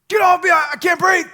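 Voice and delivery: high, loud, frantic shout to get off; panicked yell. Retold or imitated. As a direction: yell like you're being crushed. Panic under the dogpile.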